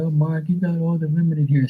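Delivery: high, mocking imitation of a complainer; mock hater. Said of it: "Why you hating on me?".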